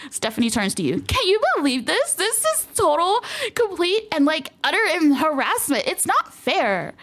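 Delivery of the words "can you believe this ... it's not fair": much higher, drawn-out complaint; indignant whine. Acted. Stephanie begs the player to agree, high indignant whine. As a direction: play the wronged drama queen.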